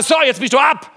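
loud, high, cold verdict; harsh dismissal. Retold or imitated. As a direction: dismiss them like a harsh judge. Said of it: "steht auf mich drauf und sagt". (hartes, lautes Urteil)

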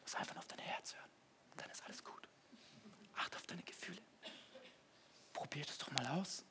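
mock-soulful whisper; whispered advice. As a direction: breathe it like secret wisdom. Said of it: "Und der eine so".

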